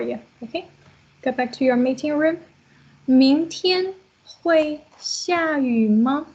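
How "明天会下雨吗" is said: very slow, word-by-word model sentence; spaced out for note-takers; slow dictation. Shown as a demonstration. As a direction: dictate it with long drawn-out words. Teacher dictates example sentence for notes.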